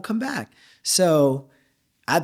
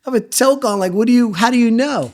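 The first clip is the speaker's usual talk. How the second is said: high, loud retold indignation; animated, baffled objection; incredulous protest; 7 semitones higher, 7 dB louder than usual. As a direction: protest in disbelief, pitch climbing. Retelling his indignant reaction to chef.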